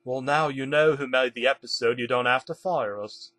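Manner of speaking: plain reading voice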